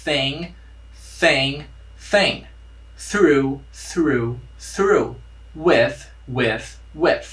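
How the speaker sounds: thing, through, with, tongue tip between teeth; soft TH drill. Shown as a demonstration. Teacher leading students in a TH repetition drill. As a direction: repeat each soft th word slowly. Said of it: "Mas repita essas palavras aqui".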